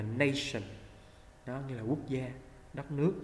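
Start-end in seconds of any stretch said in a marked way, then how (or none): 0.0-0.6 "nation" as NAY-shun; long "nay" vowel. Shown as a demonstration. Teacher shows the word below, "nation" with long "nay". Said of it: "mình đọc là nay".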